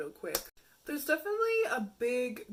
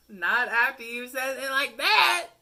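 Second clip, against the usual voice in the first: loud, higher, amused put-down; loud mock rejection; 5 semitones higher, 14 dB louder than usual. Turning down a cringe match, a loud amused rejection. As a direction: slap the line down for laughs.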